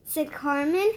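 plain reading voice

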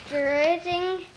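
plain reading voice

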